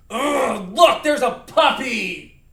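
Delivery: loud, drawn-out outburst with a growled ugh; angry. Shown as a demonstration. Teacher angry at seeing this puppy, angry. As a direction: burst out in a temper. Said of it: "We are going to be angry".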